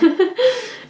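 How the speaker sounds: high-pitched little burst of laughter; real laugh. Real, not acted. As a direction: laugh lightly as the other agrees. Laughs after the other agrees.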